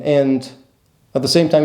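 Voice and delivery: ordinary talk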